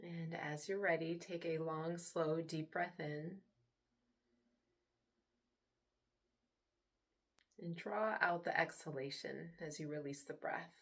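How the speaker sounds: soft, unhurried meditation voice with long pauses; guided meditation. Acted. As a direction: hush down and gently lead them into relaxation. Leads a guided relaxation.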